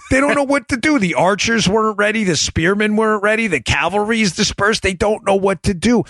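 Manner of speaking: high, agitated play-by-play of disaster; mock alarm. Host describing an army caught unprepared, high mock alarm. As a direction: pitch it high, as if the ranks are collapsing. Acts out the army's confusion.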